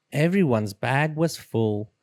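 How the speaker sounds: ordinary delivery, nothing marked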